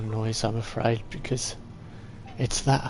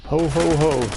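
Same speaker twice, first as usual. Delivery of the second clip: loud, high gleeful ho ho ho; gleeful laugh; 3 semitones higher, 7 dB louder, slower than usual. Real, not acted. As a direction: cackle with delight at the new gun. Gleeful at new machine gun.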